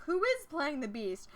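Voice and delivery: puzzled outburst breaking into a laugh; incredulous. Acted. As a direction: act out the outraged double take. Acted incredulous reaction to reveal.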